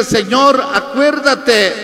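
impassioned retold prayer to the Lord; retold plea to God. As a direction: plead upward in a high, loud voice, easing slightly. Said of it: "solo dije".

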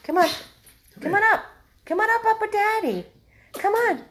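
very high sing-song call to a dog; high coaxing call. Real, not acted. Calling the dog up to his new daddy, high coaxing.

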